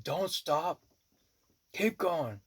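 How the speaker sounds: high-pitched egging on; retold urging. Healer egging on the widow as the lodge comes down, high urging. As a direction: urge her onward in a high, pressing voice. Urging woman on mid-outburst.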